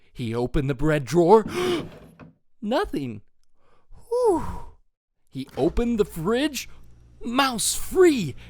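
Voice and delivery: ordinary delivery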